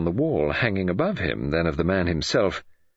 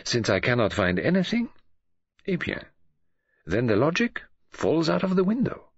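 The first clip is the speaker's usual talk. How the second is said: Poirot voice; 6 semitones higher than usual. Acted. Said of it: "said Poirot".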